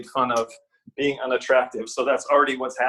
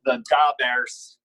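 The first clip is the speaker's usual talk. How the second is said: put-on Chicago accent; much slower than usual. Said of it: "Illinois accent".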